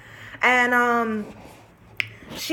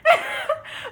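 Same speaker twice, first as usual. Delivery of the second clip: high crying laugh. Real, not acted. Laughing at memory.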